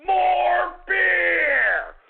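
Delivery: loud barked demand; demanding shout. Real, not acted.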